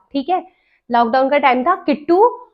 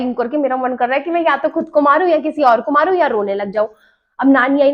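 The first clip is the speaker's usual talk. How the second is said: ordinary delivery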